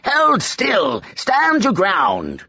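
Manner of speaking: a magician roaring orders across the room; roared command. Acted. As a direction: bellow it at the unruly novices. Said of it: "He roared at the novices".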